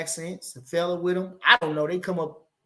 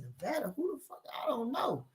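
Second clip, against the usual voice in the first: under the breath, much softer; a hushed private aside to the room; 4 semitones higher, 14 dB quieter than usual. Retold or imitated. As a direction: mutter it so they can't hear. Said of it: "I said".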